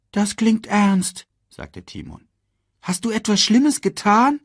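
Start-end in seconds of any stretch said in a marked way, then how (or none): 0.1-1.3 high, slow meerkat voice; concerned meerkat. Acted. Meerkat reacting to the gloomy cub, high slow remark. 2.8-4.4 high, loud meerkat question; inquiring meerkat. Acted. Meerkat questioning the gloomy cub, high urgent question.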